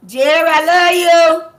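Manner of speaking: high, loud call of love across the room. Real, not acted. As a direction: sing it out to them from afar.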